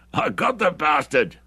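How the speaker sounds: rejoicing. A narrator's reading. Hendry gloats to Bruce about the kill, rejoicing. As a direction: savour the kill out loud. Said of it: "rejoiced Hendry".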